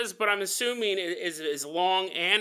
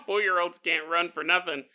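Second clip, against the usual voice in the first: ordinary delivery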